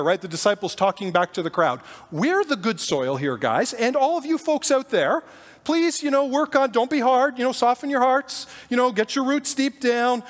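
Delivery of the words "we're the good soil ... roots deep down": smug preachy. Retold or imitated. Disciples lecturing the crowd.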